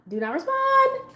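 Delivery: very high, slow, held-out self-warning; calming herself, high-pitched. Retold or imitated. Woman telling herself to hold back, high drawn-out self-calming. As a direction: stretch it out high, restraining yourself. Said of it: "so I'm calming myself".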